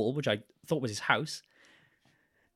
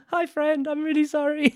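sheepish apology; 13 semitones higher, slower than usual. Retold or imitated. Apologising to the man at the door.

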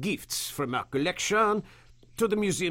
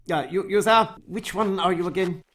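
louder, higher call across the room; 5 semitones higher, 5 dB louder than usual. Acted. Curator calls the workman over for the guests, raised call. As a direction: summon the workman sharply.

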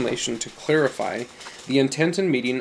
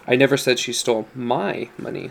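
single out "my" just before the last word; stress on MY. Shown as a demonstration. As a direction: put the weight on "my". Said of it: "depending on the emphasis you give".

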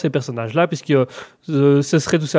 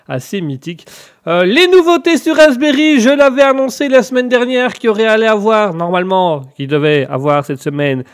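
usual speaking voice

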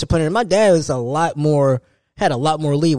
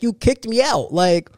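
ordinary delivery

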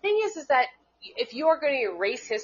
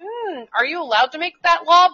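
sarcastic doubt; 7 semitones higher, 4 dB louder than usual. Retold or imitated. Podcaster mocking Atlanta mayor's mask law, sarcastic doubt. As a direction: raise it like a pointed challenge. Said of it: "And I'm like".

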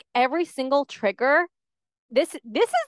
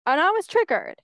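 ordinary talk, nothing marked

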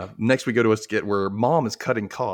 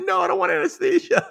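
put-on much higher little-kid voice, protesting; scared kid; 3 dB louder than usual. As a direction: refuse like a frightened boy facing the mallet. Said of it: "And he's like".

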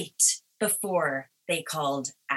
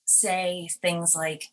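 normal talking voice

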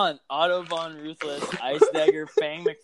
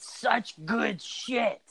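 mock Vince voice; 6 semitones higher, 4 dB quieter, much slower than usual. Mocking Vince catchphrase.

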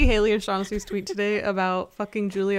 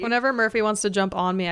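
ordinary delivery

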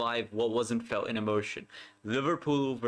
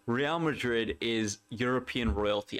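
usual speaking voice

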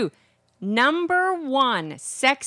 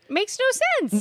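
squeaky high pitch; 12 semitones higher than usual. Real, not acted. Mocking Long Island ranking.

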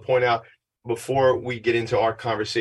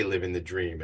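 softer, lower, going through the motions; flat stock reply; 4 semitones lower, 7 dB quieter than usual. Retold or imitated. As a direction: mumble the cliché without meaning it. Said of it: "They would just say".

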